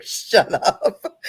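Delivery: laughing through a mock shut up. Bashful laughing reply to a boss praising her channel. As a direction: brush off the compliment with a laugh. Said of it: "I was like".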